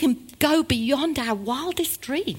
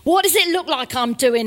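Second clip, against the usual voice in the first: louder, sarcastic retort; 4 semitones higher, 5 dB louder than usual. A narrator's reading. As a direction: scoff at the obvious question. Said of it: "he replied sarcastically".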